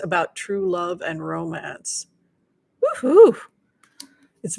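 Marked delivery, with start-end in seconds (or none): 2.8-3.4 high, loud whoop; excited woohoo; cheer. Real, not acted. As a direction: cheer her on. Cheering a steamy romance card.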